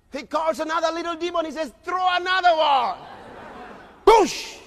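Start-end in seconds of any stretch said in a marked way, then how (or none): 1.8-4.6 loud, higher commanding devil; devil's shouted order. Acted. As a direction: yell it like the devil sending demons. Devil orders another demon.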